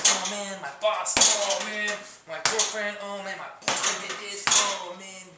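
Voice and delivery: play-acted frustrated venting; venting aggression. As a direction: gripe loudly between punches, half in play. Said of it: "take all your aggression and anger out".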